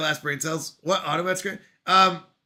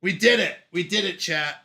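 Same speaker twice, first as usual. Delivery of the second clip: ordinary talk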